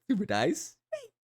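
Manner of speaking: ordinary talk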